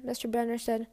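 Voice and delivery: ordinary delivery, nothing marked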